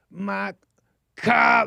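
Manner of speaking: growled, gravelly demo voice; growling. Shown as a demonstration. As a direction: growl it out from the throat. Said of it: "to get some growl in your voice".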